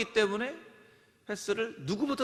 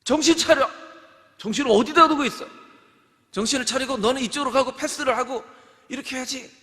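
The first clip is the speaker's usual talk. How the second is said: loud, high scolding coach; angry coach at timeout; coach yelling; 6 semitones higher, 8 dB louder than usual. Pretend. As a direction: chew out the players. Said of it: "감독이 얘기를 합니다".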